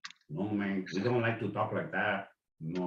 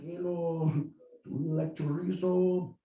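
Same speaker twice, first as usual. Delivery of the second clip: mock sly greeting; 7 semitones higher than usual. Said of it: "We're like".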